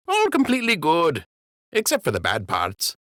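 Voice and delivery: high-voiced dockhand greeting his captain; Jimmy the docker's voice. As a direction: pitch it way up like a simple dock worker.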